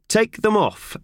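curt high command; stern order. Acted. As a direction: bark the order. Boss orders mittens off.

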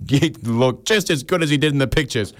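high, put-on admiring voice; a high mock swoon. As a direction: gush it like an impressed date. Friend's mock compliment quote.

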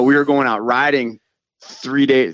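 usual speaking voice

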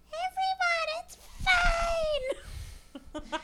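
a squeaky, helium-high reassurance. Retold or imitated. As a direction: talk as if you just breathed helium. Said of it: "and it's a helium".

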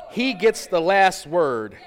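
slow, loud and ringing, weight on HE; loud proclamation. Real, not acted. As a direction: proclaim each word to the room. Preacher proclaims title line.

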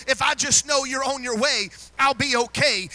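loud, high and rising, full of fire; ringing preacher's cry; full preaching shout. Real, not acted. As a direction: shout it out from the pulpit.

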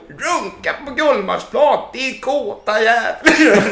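high, slow, laughing woman's voice; amused woman voice. Retold or imitated. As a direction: laugh it out, half shocked. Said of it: "man hör hennes reaktion".

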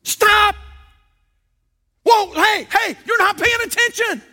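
high, urgent yelled warning; attention-getting shout. Acted. Stopping a driver who's not paying attention, urgent shout. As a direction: call out sharply to stop them. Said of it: "You're trying to get their attention".

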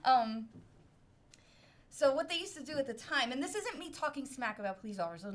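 normal talking voice

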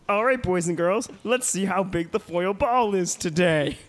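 put-on high, singsong children's host; kindergarten teacher voice. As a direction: address the kids brightly. Addresses kids as host.

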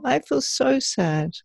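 put-on a child telling the inner parent how sad it feels. Shown as a demonstration. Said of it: "And it might be".

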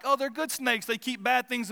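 lightly mocking snake defender; mocking snake lover. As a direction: parody someone who loves snakes. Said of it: "I'm not like".

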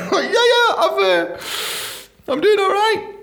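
high, squeaky fake confidence; strained casual lie; nervous bluff. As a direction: cover a lie badly. Lying into his pint.

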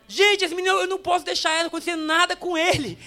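Mary panicking a little over the Son of God; anxious young mother. Acted. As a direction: fret over the baby. Mary anxious about her baby.

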